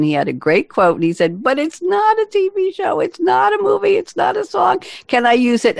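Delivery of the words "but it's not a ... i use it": very high, sing-song mock plea; imitated pleading. Host retelling the guest's plea to use his quote, high mock pleading. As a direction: put on a high little voice for his protest.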